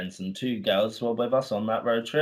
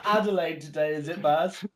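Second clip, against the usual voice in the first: laughing mock question; 6 semitones higher than usual. Teasing impression with laughter.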